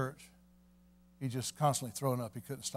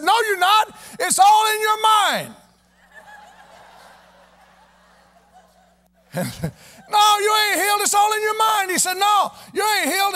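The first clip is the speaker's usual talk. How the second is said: doctor's stubborn denial; 11 dB louder than usual. Retold or imitated. Doctor denies the healing.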